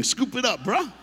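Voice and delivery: high, loud careless dude voice; careless guy. Retold or imitated. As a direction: scoop it up like you don't care. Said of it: "Fellas, what do we do?".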